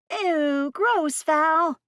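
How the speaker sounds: put-on high, squealing disgusted teen; grossed-out teen girl. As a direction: recoil like a grossed-out kid. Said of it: "The voice was impossible to miss".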